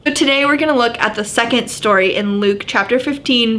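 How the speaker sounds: normal talking voice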